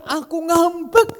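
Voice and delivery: put-on high, childlike "ngambek" with a playful lift at the end; "ngambek" sung up brightly, sulking while laughing; innocent, happy pretend sulk; Wahyu's cheerful sulk. Shown as a demonstration. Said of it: "Kalau dia kan".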